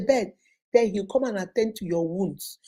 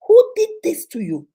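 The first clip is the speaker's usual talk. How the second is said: raised, higher cry of alarm; 5 semitones higher, 8 dB louder than usual. Retold or imitated. Sister sees bruises on FaceTime, cries out in alarm. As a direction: demand to know who hurt her.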